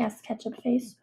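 ordinary delivery

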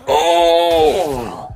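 sudden loud high cry; startled shout. Real, not acted. Knocked out by the dino, a startled shout. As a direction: jolt out a shocked yell. Gets killed in the game.

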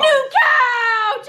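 imitating excited toddler. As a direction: copy the little boy's happy yell. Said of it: "he'll just be like".

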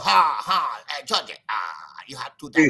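hammed-up Chinese beggar voice; mock Chinese accent. Imitating a Chinese beggar in New York, a mock accent. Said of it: "When you meet Chinese in New York".

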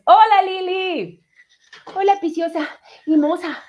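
excited greeting. Real, not acted. Streamer greeting a viewer who joined, excited. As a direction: sing out a hello, stretched and high.

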